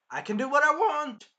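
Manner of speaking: high, loud spoiled royal; imitating a bratty king; arrogant king. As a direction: sneer it like a tyrant.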